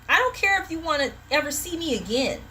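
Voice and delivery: usual speaking voice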